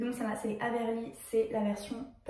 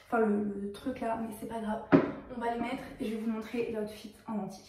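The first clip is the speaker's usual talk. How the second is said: normal talking voice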